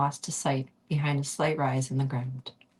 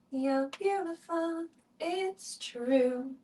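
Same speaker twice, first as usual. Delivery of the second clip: much higher, slow cooing falsetto; silly high voice; 12 semitones higher, 5 dB quieter, much slower than usual. Pretend. Reader teasing her listeners, high cooing voice.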